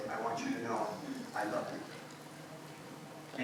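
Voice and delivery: very soft, slow, breathless whisper; slow whispered I love you; tender whisper. Retold or imitated. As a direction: hush it like a treasured memory. Boy recalls nun's loving words.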